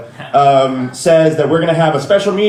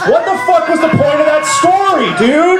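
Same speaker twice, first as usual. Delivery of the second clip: outraged yell; 14 semitones higher than usual. Acted. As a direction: scream disbelief at the absent VP. Comic rant about the VP's story.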